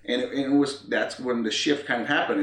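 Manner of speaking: normal talking voice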